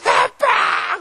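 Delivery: high, mock-wailing call; crying child imitation. Lost child calling for dad, acted wail. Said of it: "막 울어요".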